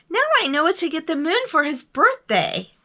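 high, loud excited bear; thrilled high voice; delighted. A narrator's reading. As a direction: announce it with glee.

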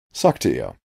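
ordinary delivery, nothing marked

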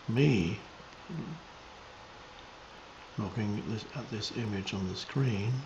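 meditative teaching, measured and spacious; contemplative spiritual guidance. Real, not acted. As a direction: guide listeners into presence, unhurried.

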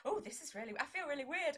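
high, loud gushing fan voice on the first oh; imitating a starstruck stranger; mock-excited fan blurting; flustered fan. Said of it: "they go".

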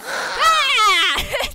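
loud high scream into laugh; startled scream. Real, not acted. Streamer jolted by a jumpscare, screaming then laughing. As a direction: let a real scare burst out as a yell.

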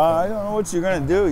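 ordinary delivery

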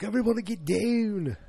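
high-pitched, slipping Australian yell; botched Australian accent. Retold or imitated. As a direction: overdo an Australian accent and lose it. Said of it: "Then I, I lost it again".

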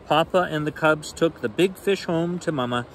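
usual speaking voice

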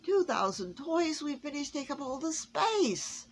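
high, pleading elf voice; the elf, near tears; distressed elf. A narrator's reading. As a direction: stammer out the workshop woes. Miserable elf complains.

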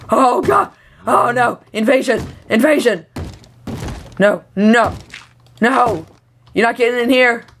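high-pitched alarm cries; playful panic. Pretend. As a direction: sound the alarm in hammed-up panic. Monsters invade the house in game.

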